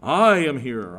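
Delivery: loud, slow, forceful rebuke; Peter rebuking. Retold or imitated. Peter rebuking Jesus, loud and forceful. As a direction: scold the Lord with heavy insistence. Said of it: "I'm kind of improvising there".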